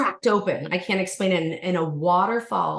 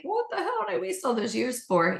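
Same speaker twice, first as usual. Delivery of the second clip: high exasperated disbelief; a high incredulous groan at herself; 11 semitones higher than usual. Retold or imitated. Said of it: "I'm like".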